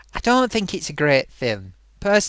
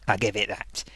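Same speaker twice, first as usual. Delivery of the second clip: grudging whispered concession; a quiet whispered admission that it is entertaining; whispered aside; 10 semitones lower, 9 dB quieter than usual. Real, not acted. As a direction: whisper it under your breath as a grudging aside.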